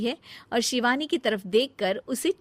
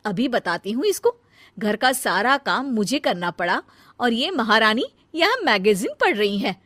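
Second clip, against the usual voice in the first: indignant; 3 semitones higher, 8 dB louder than usual. Acted. Pallavi discovers the lie.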